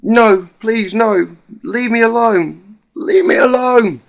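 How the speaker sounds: high, loud desperate pleading; terrified begging. Acted. Nightmare, begging attacker to stop.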